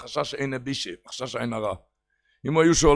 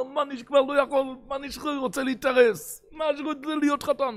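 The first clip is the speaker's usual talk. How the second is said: whining cry; 10 semitones higher than usual. Retold or imitated. Menish pleading with his mother, high and tearful. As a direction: beg like a grown son near tears. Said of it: "הוא התחיל צעקות".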